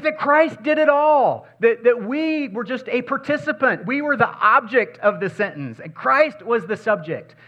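high, loud preacher's cry; full-force preaching. Acted. Pastor to the church about Christ, preaching at full force. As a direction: preach it out to the congregation.

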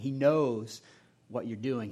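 ordinary delivery

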